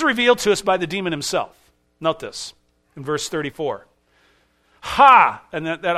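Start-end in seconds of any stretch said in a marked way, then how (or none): 4.8-5.4 a sudden loud gasp of a ha, scared not mocking; loud startled ha of fear; frightened cry. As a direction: blurt it out in terror. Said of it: "This is fear".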